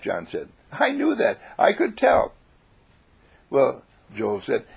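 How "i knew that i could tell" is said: high-pitched, insistent chirp; acted know-it-all glee; eager piping voice. A narrator's reading. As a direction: insist proudly in a squeaky voice. John eagerly repeats himself.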